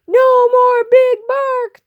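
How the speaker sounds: slow, high, loud chant of glee; crowd cheering. Acted. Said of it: "the crowd cheered".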